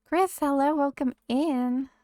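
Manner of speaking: light up greeting a viewer; excited greeting. Real, not acted.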